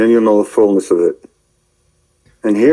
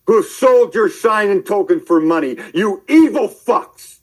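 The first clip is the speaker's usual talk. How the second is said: upset rant; 8 semitones higher than usual. Real, not acted. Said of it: "'Cause I'm so upset".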